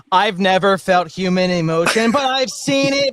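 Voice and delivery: like an alien mimicking emotion; mock alien. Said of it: "it's like".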